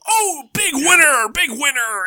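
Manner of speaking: high, loud casino cheer; excited whoop. Acted. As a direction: whoop it up like a gambler watching a win. Voicing excited gambler in story.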